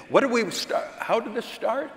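high, baffled questioning; mock bewilderment. Speaker imitates a couple forgetting why they fight, mock bewilderment. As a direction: lose the thread, pitch climbing high. Acting out a confused arguing couple.

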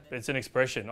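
ordinary talk

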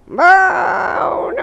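high, loud, stretched-out yell; mock shout. Player cries out at the game, long mock shout. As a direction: holler it and hold the vowel.

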